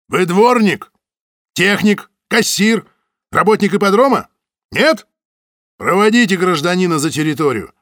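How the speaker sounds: loud, impatient interrogation. Acted. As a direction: fire the questions, fed up. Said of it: "Терпение у Самойлова лопнуло".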